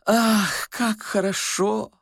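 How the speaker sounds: high, slow sigh of bliss; delighted old man. A narrator's reading. Old man hearing of the governor dream, high rapturous sigh.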